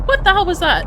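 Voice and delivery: high, loud startled cry; startled shout. Real, not acted. Gamer startled by a sudden noise, high shout. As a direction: blurt it out in shock.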